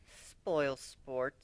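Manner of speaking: huffy. A narrator's reading. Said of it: "she said with a huff".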